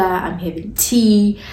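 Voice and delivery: ordinary delivery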